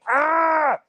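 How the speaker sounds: high-pitched "ah" of overexcitement; mock excited squeal. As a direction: imitate a guy squealing as he bites his knuckle. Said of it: "biting his fucking knuckle, like".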